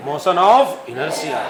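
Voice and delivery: slow, spaced-out key term; slow dictation. Shown as a demonstration. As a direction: hand them the term to write. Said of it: "dictated term".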